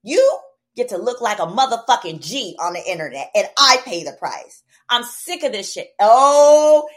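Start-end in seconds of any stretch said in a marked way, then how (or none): 0.0-5.9 retold angry rant. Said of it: "I said".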